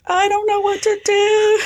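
high, lost voice; mock helpless panic. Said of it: "he's like".

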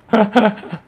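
short embarrassed giggle; nervous laugh. Real, not acted. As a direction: laugh it off awkwardly. Awkward about meeting fans.

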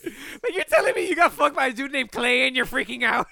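high, loud, cracking up; laughing disbelief. Real, not acted. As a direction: barely get it out through laughter. Mocking the name Clay, laughing.

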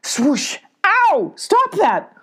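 high, loud yelps; hollering. Acted. Abby hollering at whatever swoops at her. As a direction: holler at the attacker. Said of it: "hollered Abby".

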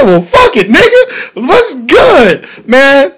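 high, loud laugh-cry relief. Retold or imitated. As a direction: celebrate through laughter. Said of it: "I said".

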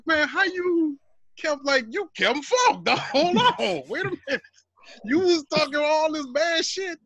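high, disbelieving, teasing; mock him in disbelief; incredulous mockery. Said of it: "And I'm thinking".

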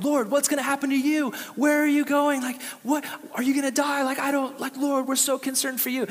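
high, anxious fretting voice; worried disciples. Retold or imitated. Disciples fretting to Jesus, high and anxious. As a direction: fret like a panicked friend.

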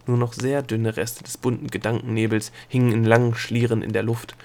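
usual speaking voice

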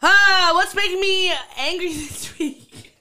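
loud, high, slow mock-dramatic question; big game-show intro with a laugh; theatrical announcement. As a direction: ham up the gripe opener.

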